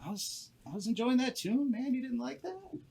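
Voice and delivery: a laid-back gnome with a different timbre; fairy gnome miffed that his tune stopped. Acted. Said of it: "he's like".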